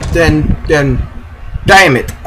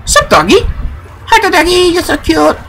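cooing at a dog; 12 semitones higher than usual. Real, not acted. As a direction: coo at the puppy. Greeting a dog in game.

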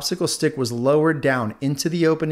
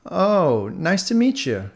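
taken aback; 6 semitones higher, 4 dB louder, slower than usual. Acted. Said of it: "a little surprised at their response".

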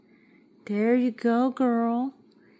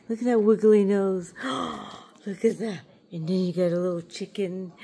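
ordinary talk

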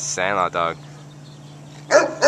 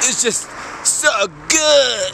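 slow, blissful so good, raised a little; mock savoring; 7 semitones higher, much slower than usual. Still joking about the tacos, slowly savoring so good. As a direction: stretch it out like pure food bliss. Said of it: "why we're talking like that".

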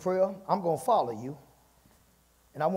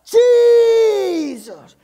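loud, high, drawn-out cry for help; long pleading holler that falls away at the end; desperate shout; 14 semitones higher, 13 dB louder than usual. Acted. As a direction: shout after someone far ahead, holding the vowel. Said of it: "She shouted".

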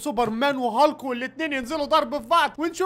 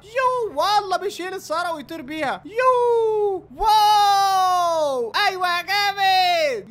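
high loud yell, then a very long stretched wow; awed whoop; 5 semitones higher, 4 dB louder, much slower than usual. Real, not acted. Gamer watching Hulk fly with a car, long loud wow.

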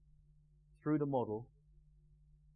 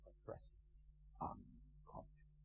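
gravelly whisper; 14 dB quieter than usual. Shown as a demonstration. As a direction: breathe it to the unconscious mind. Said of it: "Erickson, in a way".

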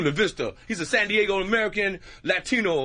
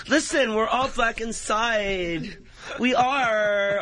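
put-on higher, drawn-out earnest girl; that one girl; 4 semitones higher, much slower than usual. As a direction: insist slowly, mock-earnest. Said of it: "You always get that one girl".

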